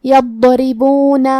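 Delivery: drawn-out chant of یضربون, long oo before the final na; heavy ḍad, thin ra. Shown as a demonstration. Teacher demonstrating tarqeeq of ra, slow tajweed recitation. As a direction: slowly recite یضربون with a thick ḍad and light ri. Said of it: "را کو پتلی آواز میں".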